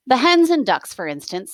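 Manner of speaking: plain reading voice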